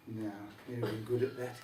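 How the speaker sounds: low, darker voice for the devil. Acted. The devil answers again.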